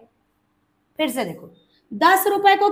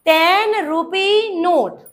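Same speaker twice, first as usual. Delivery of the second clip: drawn-out, deliberate teaching delivery; slow, evenly stretched words; slow model answer; much slower than usual. Shown as a demonstration. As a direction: give each word its own space for the class.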